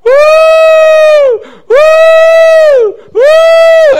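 high piercing whoops, very loud; retold shouts. Preacher imitating Brother Marvin crying out in church, loud whoops. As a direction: whoop over and over across the church. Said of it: "something way on the other side of church going".

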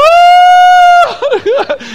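loud high woo turning into a laugh; high whooping laugh. Real, not acted. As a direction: whoop with delight at the memory. Crowd out of control.

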